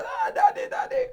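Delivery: put-on very high, wailing child's cry. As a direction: cry out to dad like a scared small child. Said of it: "we don't say so".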